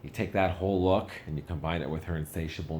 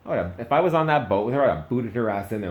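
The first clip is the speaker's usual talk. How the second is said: fast, much higher and louder, riled up; worked-up rant; 6 semitones higher, 9 dB louder, faster than usual. Real, not acted. Comic venting about the necklace thrown away, fast and loud. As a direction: rattle it off, outraged at the waste. Ranting about Titanic's ending.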